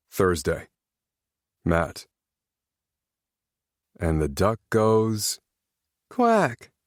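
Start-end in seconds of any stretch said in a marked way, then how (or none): 4.0-6.7 put-on slow, drowsy boy's voice with a high squeaky quack; yawning through a bedtime book; sleepy child reading aloud. Sleepy little boy reading to his dad, yawning his way to the quack. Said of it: "said with a yawn".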